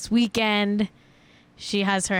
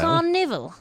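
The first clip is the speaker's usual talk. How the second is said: another go at British "Carnival", KAH-ni-vul; mock British "Carnival"; British accent; 6 semitones higher than usual. Shown as a demonstration. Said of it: "How do you say that in a British accent?".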